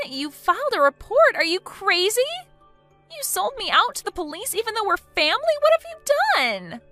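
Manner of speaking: high, loud panicked accusation; panicked outrage. Acted. Woman learning she was reported to police, panicked outrage. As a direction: shriek in betrayed panic.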